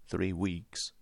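soft, guarded aside; hushed captive's reply; covert answer. A narrator's reading. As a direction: slip the answer in quietly. Captive's covert answer between defiant replies.

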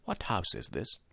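very soft aside; hushed, secretive question; covert question. A narrator's reading. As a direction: slip it in under your breath. Interpreter slips in secret question.

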